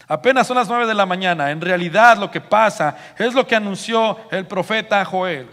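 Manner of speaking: loud, high, confident declaration; authoritative proclamation. Acted. Peter explains Joel's prophecy to the crowd, authoritative proclamation. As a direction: project it firmly to everyone. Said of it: "a hablar con autoridad, con seguridad".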